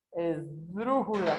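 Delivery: slowed, lean long on the stressed DRÚ of "Esdrújula"; sounded out. Shown as a demonstration. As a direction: sound out the word slowly. Teacher sounds out syllable stress. (pronunciado devagar)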